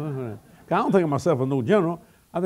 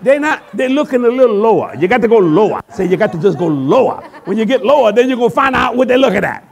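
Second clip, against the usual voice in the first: loud, laughing preaching climax, higher; full-force preaching; 6 semitones higher, 11 dB louder than usual. Real, not acted. Preacher setting the ladies straight, loud and laughing. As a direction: build the crowd up, shouting through laughter.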